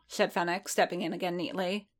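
ordinary delivery

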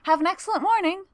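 high, polite and sweet; lightly voiced pleasant clerk. A narrator's reading. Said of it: "says a pleasant voice".

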